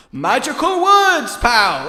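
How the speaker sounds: high, loud game show announcement; game show host. Acted. Trying to cast a spell, sounds like a game show host. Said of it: "announcement of a game show host".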